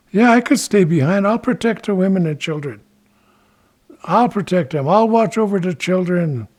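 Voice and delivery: eager Ichiko. Acted. Ichiko agrees to guard the women and children, eager.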